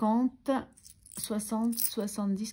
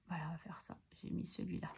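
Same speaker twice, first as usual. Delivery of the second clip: whispered aside to herself; 10 dB quieter, faster than usual. Real, not acted. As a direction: breathe it under the voice, unvoiced. Whispering aside while writing.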